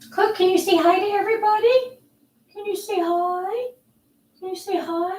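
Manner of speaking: high, sing-song pet voice; high cooing voice for Cookie. Real, not acted. Talking to her dog.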